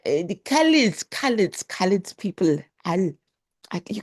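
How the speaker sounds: high, put-on South African accent on "Khaleds"; fumbling a South African accent; half-British, half-Australian stab at a South African accent; South African accent attempt. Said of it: "I'm going British, Australian".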